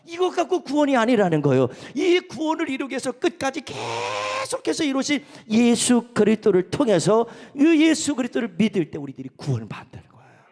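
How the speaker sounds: loud, emphatic proclamation; full-voiced sermon delivery; forceful preaching. Real, not acted. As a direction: drive the point home from the pulpit.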